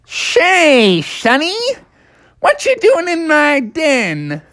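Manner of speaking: high-pitched cartoon groundhog; little critter voice. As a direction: pipe up as a tiny, testy animal. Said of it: "he heard a little voice".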